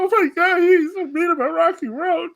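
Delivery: high, whiny, exaggerated pearl-clutching; mock offended viewer. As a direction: squeal it like a scandalized fan.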